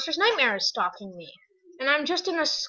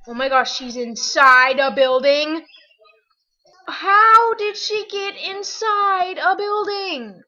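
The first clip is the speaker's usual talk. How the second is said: higher, louder and drawn out in disbelief; amazed exclamation; 7 semitones higher, 9 dB louder, slower than usual. Real, not acted. Player finds friend inside building, loud amazed disbelief. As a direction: marvel at it, slow and loud.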